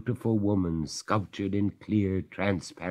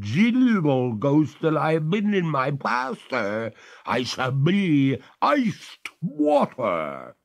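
high, louder, slowing threat; threatening ghost; 12 semitones higher, 6 dB louder than usual. A narrator's reading. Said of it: "cried the lady threateningly".

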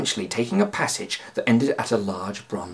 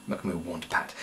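plain reading voice

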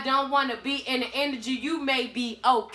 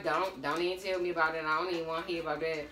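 fast, softer, done with it; quick dismissive brush-off; 8 semitones lower, 7 dB quieter, faster than usual. Retold or imitated. Telling friends she's moved on, quick quiet brush-off. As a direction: rattle it off low and fast to shut him down.

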